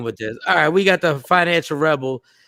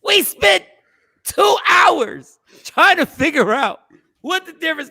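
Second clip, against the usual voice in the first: exasperated shout; 10 semitones higher than usual. Real, not acted. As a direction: throw it out in disbelief. Exasperated climax of the story.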